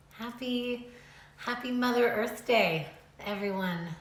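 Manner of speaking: ordinary talk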